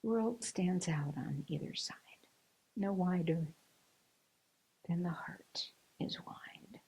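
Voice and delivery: hushed, soft verse with long pauses; poem recitation. Acted. Speaker closing the story with a poem, hushed recitation. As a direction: recite it slowly and softly, near a whisper at times.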